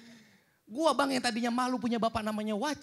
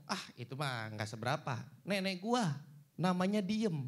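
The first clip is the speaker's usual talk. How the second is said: low, quiet, offhand brag; lightly voiced smug friend; dismissive retort; 5 semitones lower, 6 dB quieter than usual. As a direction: wave it away, unimpressed.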